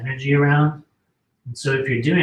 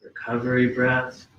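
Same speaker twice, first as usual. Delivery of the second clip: guided breathing; 4 semitones lower, much slower than usual. Acted. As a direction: breathe with them, calm and unhurried. Leads a breathing exercise.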